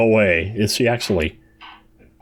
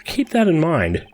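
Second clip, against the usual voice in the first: higher repeat; 7 semitones higher than usual. Acted. Player redoing a character's reply, higher voice.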